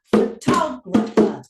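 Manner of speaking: pho-TOG-rapher, TOG hit loud; stress on second syllable. Shown as a demonstration. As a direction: beat out photographer syllable by syllable, strong TOG. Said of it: "see where the stress changes".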